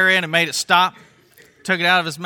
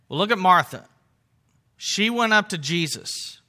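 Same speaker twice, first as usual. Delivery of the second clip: ordinary delivery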